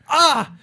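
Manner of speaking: a high, loud "Ah!" aping the friend; mocking shriek. Friend mimics the storyteller's outburst, a loud mocking shriek. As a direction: shriek it back at him, mocking. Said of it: "you point to yourself and you're like".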